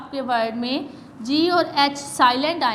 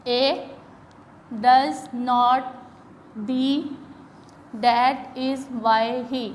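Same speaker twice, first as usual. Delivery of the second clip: slow reading; much slower than usual. Shown as a demonstration. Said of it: "जैसे कि".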